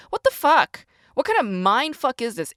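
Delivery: high, loud burst of outrage; outraged disbelief. Retold or imitated. Worker reacting to managers' confusing scores, outraged. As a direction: blurt it out in indignant disbelief.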